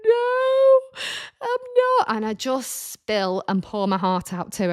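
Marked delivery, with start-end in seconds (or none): 0.1-2.2 high, crying no I'm not; tearful reply. Retold or imitated. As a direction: let the voice crack into tears, pitched high. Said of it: "And I'm like".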